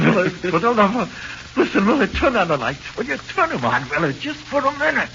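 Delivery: high, desperate plea, voice climbing; terrified pleading. Acted. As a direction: beg in panic like he's losing his mind. Terrified prisoner begging in darkness.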